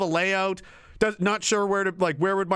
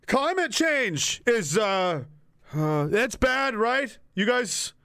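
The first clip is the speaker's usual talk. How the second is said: slow, halting mock speech with long uhs; floundering politician; slower than usual. Lost politician begging the crowd to stop him, floundering. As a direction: stall through the speech, unsure of every word. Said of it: "He's going up to podiums and give speeches".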